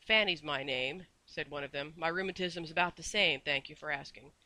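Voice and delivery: usual speaking voice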